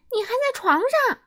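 can't believe he's still asleep; high shocked scolding; shocked exclaim. Acted. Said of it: "他惊呼起来".

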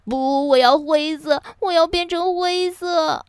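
high, drawn story-book wailing; 嚎啕大哭. A narrator's reading. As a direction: bawl the demand like a child. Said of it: "嚎啕大哭了起来". (高声哭喊着要求)

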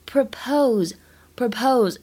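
"Propose" with weight on POSE; stress on second syllable. Shown as a demonstration. Teacher drills word stress for learners, "propose" stressed on POSE. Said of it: "要注意一下它重音的位置".

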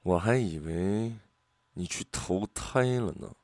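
subdued, icy male character voice; grim, cold. A narrator's reading. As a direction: say it coldly and slowly, glaring. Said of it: "他面目森然地瞪了我半分钟".

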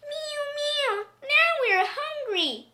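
very high kitty whine; plaintive, high-pitched cat voice; meowing cats. A narrator's reading. Hungry cats complain to the old man, high meowing. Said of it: "said the hundreds of cats".

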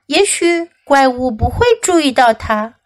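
ordinary delivery

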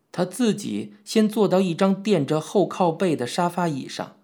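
ordinary delivery

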